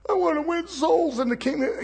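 lightly voiced fake-pious believer; mock pious plea. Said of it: "you're conning him when you say".

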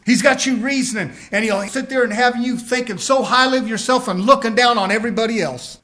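ordinary talk, nothing marked

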